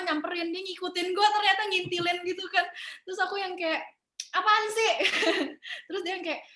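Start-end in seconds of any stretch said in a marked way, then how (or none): 4.3-4.9 annoyed retort. Retold or imitated.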